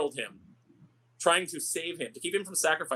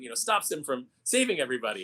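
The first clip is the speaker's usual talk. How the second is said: ordinary talk, nothing marked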